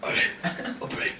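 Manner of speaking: soft, voiceless mock whimper; barely audible, about to sob; tearful reluctant prayer. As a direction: imitate a choked-up man giving in. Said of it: "he starts sobbing".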